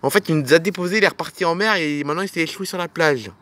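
normal talking voice

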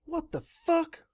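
high shrieking scream; shrieking in disbelief at a brother; screech. A narrator's reading. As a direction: screech it at the top of the voice. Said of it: "I screeched".

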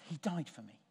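a quiet personal confession; under your breath. Shown as a demonstration. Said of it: "just under your breath".

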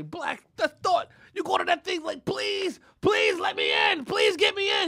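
high, loud hammed-up pleading; mock begging. Begging a friend for party entry, mock desperate. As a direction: whine and holler like a desperate beggar. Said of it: "I beg him".

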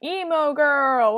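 slow loud drawn-out shout; loud call-out. Real, not acted. Gamer loudly calls out another player's name.

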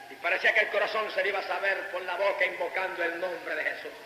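normal talking voice

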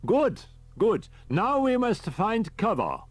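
put-on high, fussy elderly professor; a high old professor calling on the radio; professor's voice. A narrator's reading. Riker on the radio.

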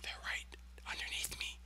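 hushed whisper. Real, not acted. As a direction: whisper so they can't hear. Sneaking up on nearby enemies.

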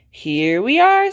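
slow sing-song arrival call; sing-song announcement. A narrator's reading. Mummy Pig announces arrival.

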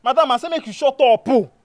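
loud, high-pitched order to shut up; exasperated shout over the quarrel; angry silencing. Acted.

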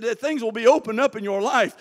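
ordinary talk, nothing marked

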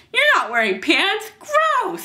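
high-pitched, loud revulsion; recoil, voice shooting up; disgusted. Acted. Girl is disgusted.

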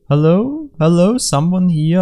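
high, questioning sing-out; calling out. Pretend. Player seeking someone to interact with, calling out. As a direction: call into emptiness with rising pitch.

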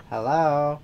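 ordinary delivery

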